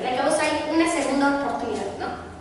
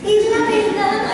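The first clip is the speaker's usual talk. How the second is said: higher, louder, slow proclaiming; emphatic cry; 8 semitones higher, 6 dB louder, slower than usual. Acted. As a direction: proclaim it to the whole church. Repeats the truth twice.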